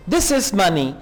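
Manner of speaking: ordinary delivery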